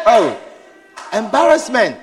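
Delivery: ordinary delivery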